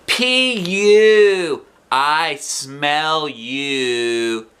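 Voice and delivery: high, drawn-out teasing; mock a classmate with a slow sneer; taunting boy. A narrator's reading. Jim teasing Junie B, sing-song taunt. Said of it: "he said".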